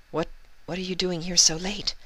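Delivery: relieved question. Acted. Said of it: "sighing in relief".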